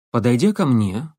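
ordinary delivery, nothing marked

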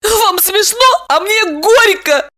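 very high, slow squeaky woman; bitter exclamation. A narrator's reading. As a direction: cry out in a wounded squeal. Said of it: "воскликнула Липочка".

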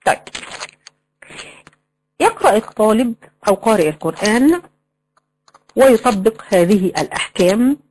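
usual speaking voice

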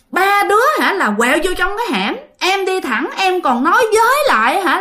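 high-pitched, exasperated retelling; annoyed insistence. Acted. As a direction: insist on your version, acting out frustration. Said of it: "Xong cái ông Út mới tức". (giọng bực tức, phân bua, lên cao)

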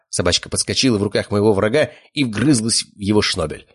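ordinary delivery, nothing marked

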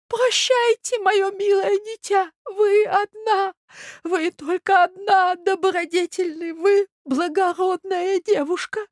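very high, drawn-out sentimental voice; old prince, mournful farewell. Acted. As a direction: bid a tender, doddering goodbye. (старый князь, прощается высоко и жалостно)

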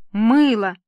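loud, scornful exclamation; theatrical despair. A narrator's reading. Actor papa wrings his hands over his serials, theatrical despair. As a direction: lament it like a wronged actor.

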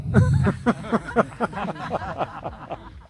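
high burst of laughter; long laugh tailing down after a joke; laughing at their own bit. Real, not acted. Laughs at own imitation of fans.

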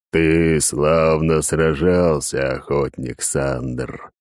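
deep, slow, booming spirit voice; otherworldly booming voice. A narrator's reading. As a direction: drone it like a ghost from beyond. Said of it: "прогудел потусторонний голос".

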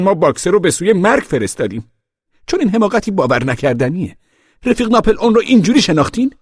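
high-pitched protesting voice, mounting in volume; indignant pig. A narrator's reading. Pig dismissing the animals' rumour, indignant high voice.

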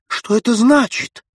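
high, loud frenzied demand; frenzied shout. A narrator's reading. Runevsky demands an answer from the ghost, frenzied shout. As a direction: shout it out in terror. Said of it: "закричал он в исступлении".

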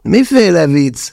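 high, slow, indignant; flaring up. A narrator's reading. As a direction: bristle with anger. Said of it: "fortyant fel".

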